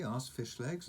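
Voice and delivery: ordinary delivery, nothing marked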